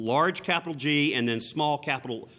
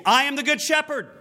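much higher, louder, declaring it; ringing proclamation; 13 semitones higher, 6 dB louder than usual. Acted. Jesus declaring himself to the crowd, ringing and loud.

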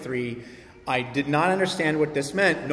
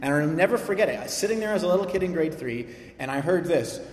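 ordinary talk

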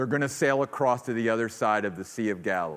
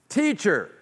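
high, loud frantic plea over the storm; panicked disciples; 9 semitones higher, 6 dB louder than usual. Acted. Disciples shouting to sleeping Jesus, frantic. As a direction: cry out to wake him, terrified.

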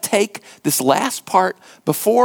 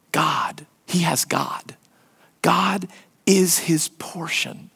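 hushed whisper of awe; 2 semitones lower, 5 dB quieter, slower than usual. Real, not acted. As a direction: breathe it out, barely voiced. Climactic whispered declaration in sermon.

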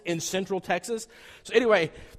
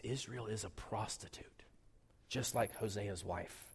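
whisper; 8 semitones lower, 14 dB quieter than usual. Real, not acted. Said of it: "hushed shocking reveal".

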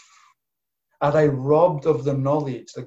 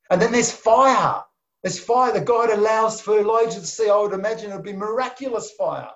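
higher and louder, swept up by the picture; rising excitement; 6 semitones higher, 4 dB louder than usual. Real, not acted. Preacher describing the miraculous fire, excited and loud. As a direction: build it up with wonder.

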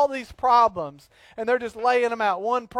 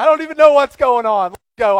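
loud, high, eager rallying cry; hyped-up loyalty; 6 semitones higher, 13 dB louder than usual. Retold or imitated. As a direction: pump yourself up like a loyal friend. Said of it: "ride or die".

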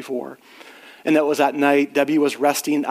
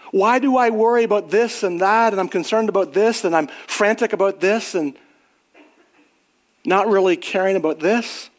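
raised, higher preaching voice with urgent pleading; 6 semitones higher than usual. Acted. Pastor challenging his church about their priorities, fervent preaching. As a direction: press the congregation hard with rising questions.

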